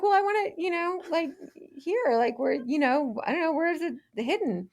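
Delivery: high, hesitant retold voice; anxious patient pointing at the scar, high and halting; fussy self. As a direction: play your nervous younger self, pitched high. Herself, retold, asking about scar.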